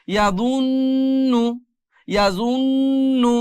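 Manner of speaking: জান্না with the ন্ন hummed long through the nose; held nasal ghunnah. Shown as a demonstration. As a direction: stretch the nasal hum in জান্না. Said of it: "গুনগুন আওয়াজ".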